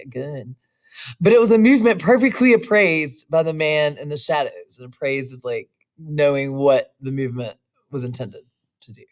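plain reading voice

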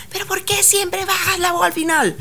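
high scolding coach imitation, mild. Coach scolding a young anchor, high mock scold. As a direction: play the coach who scolds her for dropping her voice. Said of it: "ella me regañaba".

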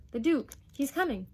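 put-on loud, high boyish answer; urgent announcement. Boy tells mother who is coming, urgent. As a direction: blurt out the bad news.

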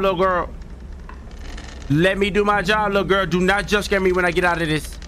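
high nervous pleading; frightened begging; scared horror game streamer. Real, not acted. Begging monster while hiding.

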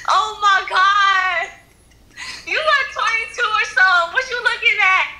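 very high-pitched yelling; high, confrontational yelling. Real, not acted. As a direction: yell it in a piercing high voice. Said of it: "They was yelling".

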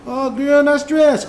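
high, mock-sweet girl talk; sassy Cookie impression. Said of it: "Cookie did her Cookie thing".

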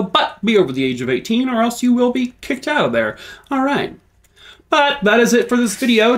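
pep it up high for the outro; bright outro pitch. Real, not acted. Vlogger wrapping up, chirpy high voice.

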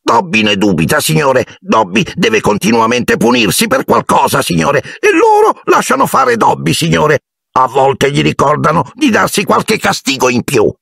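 a servant too anxious to begin; fretful house-elf. A narrator's reading. Dobby explains punishments.

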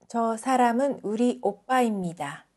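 ordinary delivery

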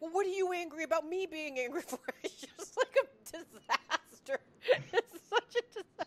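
high, loud laughing retort; laughing exasperation. Retold or imitated. Retelling the fight with her partner: high laughing exasperation. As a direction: laugh through the comeback. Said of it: "I'm like".

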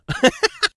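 lautes, hohes Auflachen; laut über den eigenen Versprecher losprusten; echtes Lachen. Real, not acted. (lautes Lachen)

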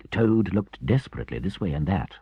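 usual speaking voice

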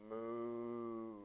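low, soft mooing like a cow. Shown as a demonstration. Yoga teacher showing the kids the cow sound, low moo. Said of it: "So you say".

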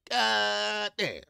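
high, long-held holler of admiration; exclamatory shout. Real, not acted. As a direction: holler it, stretching the first syllable. Exclaims over Southern girls.

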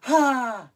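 sharp loud high "Ha!"; imitating a yoga teacher's shout. Imitating her yoga instructor's pose cry, loud sharp shout. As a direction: bark it out like her instructor did. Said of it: "she always went".

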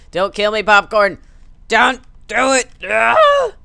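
loud, high begging into a scream; mock terrified plea. Rider begging the popcorn for his life, mock terror. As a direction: beg frantically, building to a falsetto shriek.

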